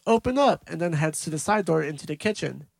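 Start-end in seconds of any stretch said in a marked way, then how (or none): 0.1-0.6 short, higher and louder mock shout; retold yell. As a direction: call out like the man in the story. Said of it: "He yells".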